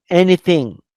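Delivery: normal talking voice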